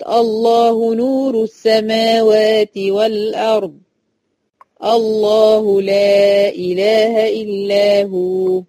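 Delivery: الله نور السماوات chanted very slowly with a full heavy lam; heavy lam, recited. Shown as a demonstration. Teacher chanting the verse for students: heavy lam in الله. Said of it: "يبقى هتفخم".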